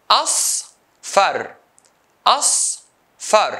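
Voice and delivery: "Asfar" broken as as, pause, far, each time; syllable by syllable. Shown as a demonstration. Teacher dividing colour words, syllable by syllable. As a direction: say "Asfar" in two separate beats. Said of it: "words into syllables".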